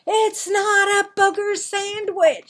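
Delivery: high, loud exasperated yell; angry shout. Acted. Marvin shouts back at the mocking kids, high and loud. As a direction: shout it, fed up. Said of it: "shouted Marvin".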